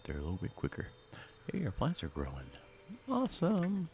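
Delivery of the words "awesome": delighted. Real, not acted. Plants growing, delighted.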